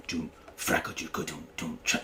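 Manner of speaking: soft, breathy beatbox of kick and snare; vocal drum groove. Shown as a demonstration. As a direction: mouth the drum pattern quietly and low.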